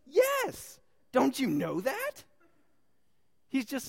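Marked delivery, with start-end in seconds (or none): 0.1-2.2 loud, high-pitched, incredulous comeback; emphatic retort as Jesus. Acted. Jesus answering the doubting disciples, emphatic. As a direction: answer as if it's utterly obvious, loud. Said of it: "And Jesus says".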